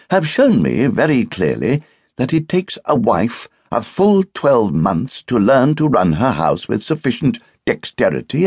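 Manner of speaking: pompous guest. A narrator's reading. Guest tells Barbara why he stayed away, pompously. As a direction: lecture the hostess with mock solemnity.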